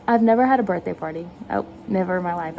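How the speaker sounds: ordinary talk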